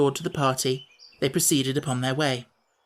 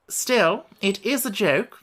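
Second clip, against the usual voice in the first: a lightly voiced, firmly insistent Wogglebug; 6 semitones higher, slower than usual. Wogglebug to Tip, firmly defending his joke. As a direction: state it firmly, a little higher. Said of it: "declared the Wogglebug firmly".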